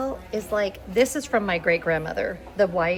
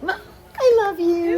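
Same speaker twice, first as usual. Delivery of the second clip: much higher and louder, crying it out; blurting love through real sobs; 12 semitones higher, 8 dB louder than usual. Real, not acted. Thanking her hairdresser at the end of the journey, crying.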